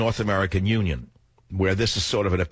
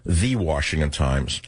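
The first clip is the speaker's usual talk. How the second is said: usual speaking voice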